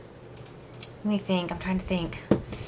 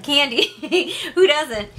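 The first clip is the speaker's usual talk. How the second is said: laughing; 6 semitones higher than usual. Real, not acted. Laughing about loving candy.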